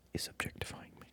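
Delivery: normal talking voice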